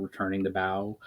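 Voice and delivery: plain reading voice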